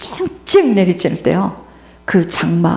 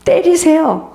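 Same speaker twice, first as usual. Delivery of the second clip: higher, bold "hit me"; defiant boy; 6 semitones higher, slower than usual. Retold or imitated. Small schoolboy dares the teacher to hit him, defiant. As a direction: stand up and dare the teacher. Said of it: "제가 그럽니다".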